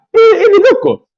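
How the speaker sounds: amazed exclamation. Real, not acted. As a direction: burst out in disbelief. Amazed exclamation, far higher.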